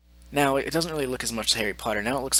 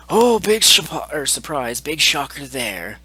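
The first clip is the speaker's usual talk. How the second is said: sarcastic surprise, starting high and loud; mock surprise; 5 semitones higher, 7 dB louder than usual. Vlogger learning troll is a cod kid, mock surprise. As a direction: hit the opening loud and high, feigning shock.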